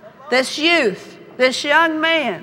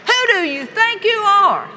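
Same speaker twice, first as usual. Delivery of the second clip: defiant shout; 7 semitones higher than usual. Acted. Preacher confronting life's giants.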